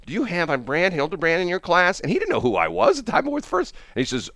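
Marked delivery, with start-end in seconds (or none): 0.1-2.0 higher-pitched lady paging over the intercom. Retold or imitated. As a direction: imitate a school office calling a classroom. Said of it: "the lady comes over the intercom".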